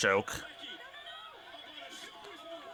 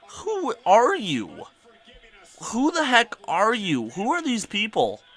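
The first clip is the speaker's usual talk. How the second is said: high, drawn-out incredulity; exaggerated bewilderment; 8 semitones higher, slower than usual. Venting about odd schoolmates, theatrically baffled. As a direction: swoop up high and stretch each question.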